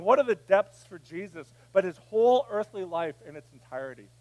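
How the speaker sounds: usual speaking voice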